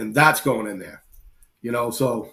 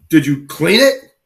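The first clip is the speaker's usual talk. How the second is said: slow, louder question climbing at the end; incredulous butcher; 3 semitones higher, 7 dB louder, slower than usual. Retold or imitated. Butcher grilling hunters about their pig, incredulous. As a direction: draw out "clean it" in mock disbelief.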